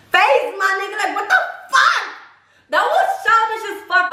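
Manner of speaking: high, indignant outburst; outraged. Real, not acted. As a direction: let the outrage burst out high. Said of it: "I was pissed off".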